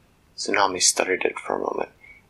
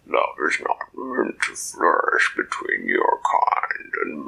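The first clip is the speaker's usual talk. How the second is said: much lower, slow, hollow monster voice; deep kraken voice; 9 semitones lower, slower than usual. Acted. Kraken mocks Dak for coming to die, low slow monster voice. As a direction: speak like a vast creature from the deep. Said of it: "in that deep underwater voice".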